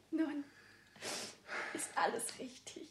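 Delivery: hushed whisper. Acted. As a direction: breathe it intimately. Intimate aside with ring.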